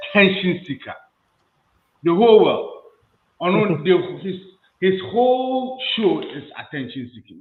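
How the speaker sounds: high, loud scornful jab; mocking outrage. Real, not acted. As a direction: scoff at the showman pastor. Mocking a pastor, heated.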